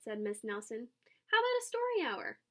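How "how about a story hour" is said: high, bright offer; teacher proposing story hour, cheerful. A narrator's reading. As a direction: lift it up invitingly.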